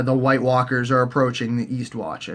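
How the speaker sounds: ordinary talk, nothing marked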